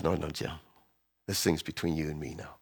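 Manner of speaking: very soft, low and intimate; hushed voice of God. Retold or imitated. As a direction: speak low, as God to one man. God speaks quietly to pastor.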